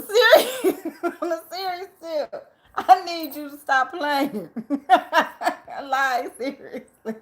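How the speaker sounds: laughing through the words; can't keep a straight face. Real, not acted. Laughing while pleading.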